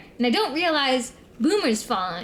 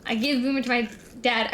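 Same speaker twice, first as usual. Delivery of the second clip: ordinary delivery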